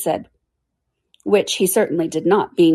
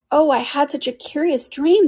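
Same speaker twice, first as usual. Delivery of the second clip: excited; 9 semitones higher than usual. Acted. Alice wakes excited.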